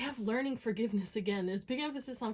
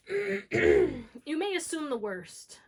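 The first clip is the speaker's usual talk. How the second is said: slow and louder, each word weighed heavily; slow stressed warning; 5 semitones higher, 7 dB louder, much slower than usual. Shown as a demonstration. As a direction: draw it out to make it sink in. Repeats warning for emphasis.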